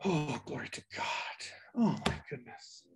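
breathy hushed exclamation; whispered aside. Real, not acted. Preacher whispering to himself after the interruption. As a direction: sigh it under your breath.

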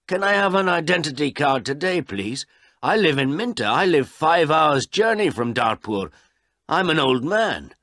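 put-on high, loud pleading old man. Old man begging the official for his card, high and loud. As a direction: beg the official for the card today.

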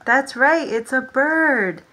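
plain reading voice

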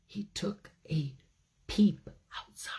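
quick cautious whisper. A narrator's reading. Telling children the bear peeks out, whispered. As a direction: peek out in a hush.

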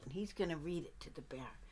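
hushed storytelling whisper. A narrator's reading. Narrator whispering to the child as the duck reads. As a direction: whisper it like a secret.

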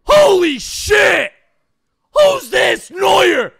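loud, thrilled yell at a big pull; excited shout. Real, not acted. Gamer opening a pack, shouting at a star pull. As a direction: explode with joy.

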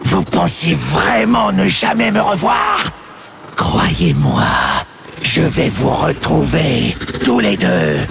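demon shouting threats, wild swings; raging demon threat. Acted. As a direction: promise vengeance in fury. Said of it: "bouillonner de rage". (menace rageuse du démon à Aléria et Turalion)

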